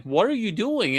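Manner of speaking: high, exasperated what are you doing; incredulous demand. Retold or imitated. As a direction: push her for an answer. Confronting wife over hidden charms.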